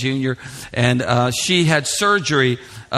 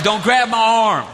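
higher, slow comic threat; mock angry warning; 6 semitones higher, 3 dB louder, slower than usual. Italian dad warning whoever stops him, drawn-out mock threat. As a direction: draw out the warning in mock outrage.

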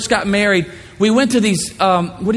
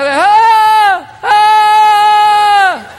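loud, high two-note holler; high holler call; 7 dB louder than usual. Shown as a demonstration. Husband hollering for his wife at a craft show, loud high call. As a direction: holler to find her in the crowd. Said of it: "a holler that I would do".

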